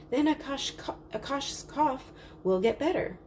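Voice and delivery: put-on high, eager little-girl plea; eager child.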